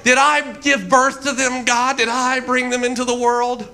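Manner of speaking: high, indignant protest to God; hammed-up aggrieved question; Moses. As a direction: complain upward in mock outrage. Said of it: "can you hear his voice".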